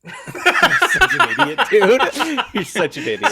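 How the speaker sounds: high, loud words squeezed through laughter; helpless laughter. Real, not acted. To a friend joking about guns, helpless laughing tease. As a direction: crack up while teasing a friend.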